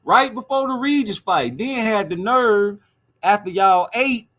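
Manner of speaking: mocking a guest's food breath; mocking roast. Real, not acted. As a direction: lay it on thick.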